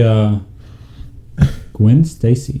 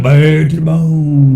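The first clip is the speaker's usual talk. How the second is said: slow, drawn-out macho growl of the song line; tough-guy voice; 3 semitones higher, much slower than usual. Pretend. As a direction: play it cool and slow like a badass. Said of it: "doing that voice".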